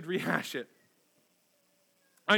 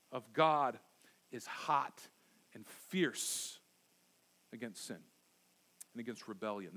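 hushed gravity; 8 semitones lower, 6 dB quieter than usual. Acted. Sermon on God's wrath.

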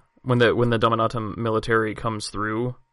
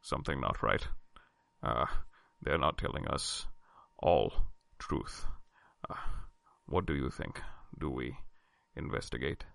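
much lower, softer broken English; low gruff character voice; 11 semitones lower, 8 dB quieter than usual. Acted. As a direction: growl it low like a rough brute. [name] speaks in character to Tess.

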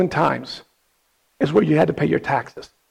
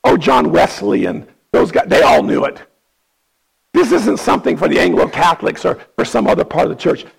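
ordinary delivery

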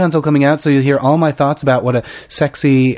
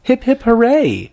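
mock cheer; 10 semitones higher, slower than usual. Mock cheer over the reviews.

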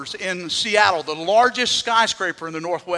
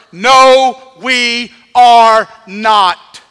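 emphatic denial; 3 semitones higher, 13 dB louder, much slower than usual. Real, not acted. Pastor's forceful rebuttal.

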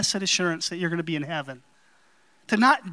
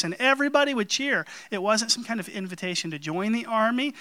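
usual speaking voice

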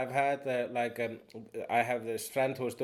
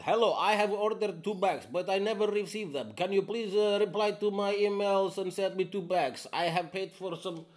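imitating a customer in a Slavic accent, higher; mock Slavic accent, pitched up; a lightly hammed Slavic customer; 8 semitones higher than usual. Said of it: "I am sorry about the accent".

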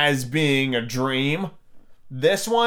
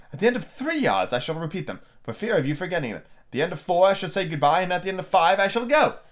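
higher-pitched, measured-out storybook Queen; instructing Queen, building higher to the last; Queen giving directions; 3 semitones higher, faster than usual. Acted. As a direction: count off each step in a raised, lilting voice. Reads the Queen's dialogue.

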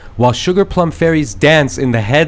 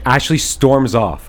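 normal talking voice

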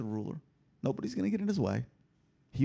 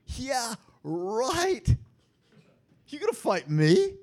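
higher and louder, a sarcastic "Yeah, right"; mock dismissive sneer; scoffing; 8 semitones higher, 4 dB louder, much slower than usual. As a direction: scoff at them as the Lord. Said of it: "The Lord scoffs at them".

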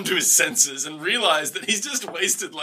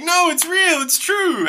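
high, louder protest; mock earnest insistence; 11 semitones higher, 4 dB louder, slower than usual. [name] insists to his doubting family, excited mock plea. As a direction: plead to be believed.

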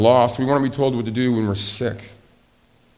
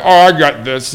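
cocky handyman; 2 semitones higher, 9 dB louder than usual. Pretend.

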